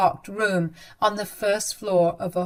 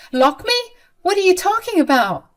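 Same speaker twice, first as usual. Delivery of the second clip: high, louder disbelieving question; shocked disbelief; 9 semitones higher, 3 dB louder than usual. Acted. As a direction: son tells mother she'll be locked in, shocked disbelief. Mother learns she'll be locked in.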